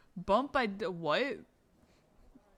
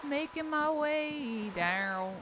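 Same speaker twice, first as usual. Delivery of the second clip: slow, high silly singing voice; goofy voice; 5 semitones higher, 5 dB quieter, slower than usual. Acted. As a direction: croon it like a goofball. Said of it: "sorry, goofy voice".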